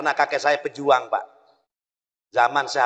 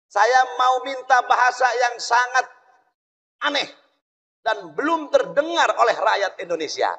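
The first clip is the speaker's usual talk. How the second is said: lightly voiced Bung Karno; 8 semitones higher than usual. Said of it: "kata Presiden Bung Karno".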